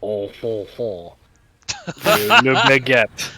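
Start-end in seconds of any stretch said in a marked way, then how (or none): 1.9-3.3 slow, loud mock French accent. Shown as a demonstration. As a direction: ham up a French spy, very slowly. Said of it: "Give us your best spy impression".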